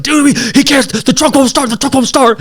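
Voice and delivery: very high, loud frantic voice; frantic panic. Retold or imitated. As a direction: squeal it in a panic. Friend panicking to guy behind.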